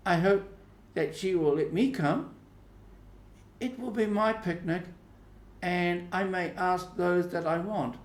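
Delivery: put-on high, bright-eyed girl chattering about her picnic; a girl showing off her party plans, pitched high; eager schoolgirl Becky. Becky to Mary, bragging about her picnic, high and eager.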